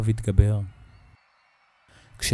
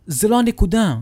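much higher, louder cut-off; urgent outburst; 11 semitones higher, 7 dB louder than usual. Acted. Urgent argument with sister. (התפרצות דחופה)

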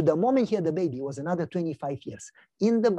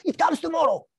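put-on much higher, proud child's voice; proud little boy; 11 semitones higher, 3 dB louder than usual. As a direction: announce it like a proud toddler. Son tells kids.

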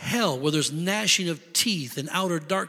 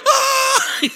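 sharp high startled cry; a crowd's shocked yelp; mock startled shriek; 6 dB louder than usual. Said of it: "everybody's like".

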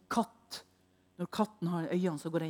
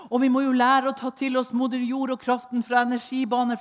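higher, much louder sarcastic preaching; sarcastic tirade mocking the yoga teachings; 5 semitones higher, 9 dB louder than usual. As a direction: scoff at the yoga steps.

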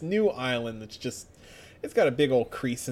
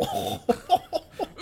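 evil laugh. Acted. Vegeta laugh.

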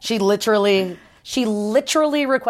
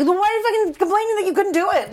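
fast, much higher exasperated rant; exasperated retort; 11 semitones higher, much faster than usual. Retold or imitated. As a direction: rattle it off in disbelief. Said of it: "And it's like".

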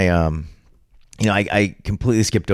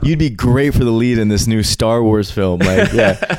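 higher, louder excited pitch to a stranger; higher gushing mock casting voice; mock casting director; 7 semitones higher, 3 dB louder than usual. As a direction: play a gushing Hollywood casting agent.

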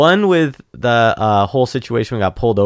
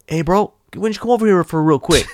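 higher mock agent voice; 7 semitones higher, faster than usual. Mocking the agent calling him over, pitched up. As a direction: imitate the pushy agent. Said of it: "and like".

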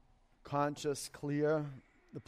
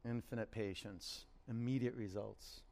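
soft repeat; 3 semitones lower, 7 dB quieter than usual. Acted. Repeats the mantra softly.

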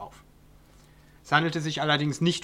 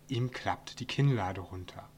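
ordinary delivery, nothing marked

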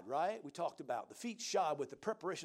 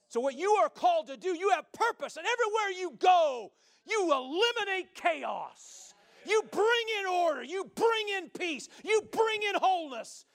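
rising, loud and high, building to a shout; preaching; 10 semitones higher, 6 dB louder than usual. Acted. Preacher rallying his congregation, loud climbing preaching. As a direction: preach it out to the congregation, louder each line.

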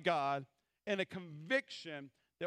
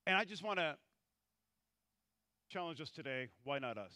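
ordinary delivery

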